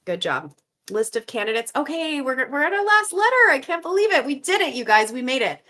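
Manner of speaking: normal talking voice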